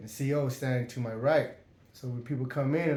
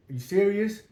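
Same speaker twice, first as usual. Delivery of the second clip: incredulous challenge; 7 semitones higher, 4 dB louder than usual. Retold or imitated. Challenging the guard.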